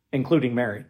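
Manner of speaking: ordinary talk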